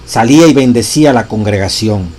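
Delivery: loud, solemn reading of a sacred text; preacher reading scripture with force; reading a quotation aloud. Acted.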